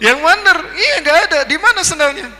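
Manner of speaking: high, loud squabbling back and forth; excited comic banter. Acted. Idris tells the angel his sandal was left in heaven, high excited banter. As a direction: play both sides of the sandal mix-up with amused alarm.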